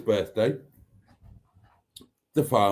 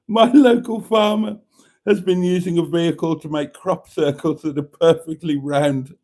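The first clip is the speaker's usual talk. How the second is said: barely holding in laughter, higher and louder; laughing through it; 6 semitones higher, 6 dB louder than usual. Real, not acted. Host reading a listener's pun, laughing through it. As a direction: read it while cracking up.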